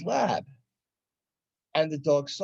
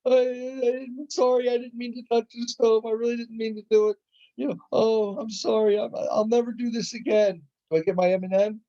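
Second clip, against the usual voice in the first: put-on much higher, pleading child's voice; sorry child; 9 semitones higher than usual. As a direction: beg forgiveness like a little kid. Child apologising after burning hand.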